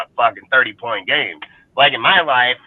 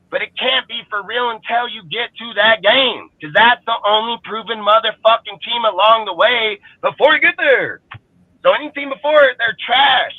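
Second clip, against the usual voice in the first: heated rant; 8 semitones higher than usual. Real, not acted. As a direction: hammer the point home, voice climbing high. Heated rant about proven teams.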